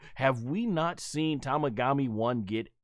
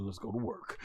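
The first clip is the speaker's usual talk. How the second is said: low, quiet cool hero line; 8 semitones lower, 10 dB quieter than usual. Retold or imitated. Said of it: "He's like".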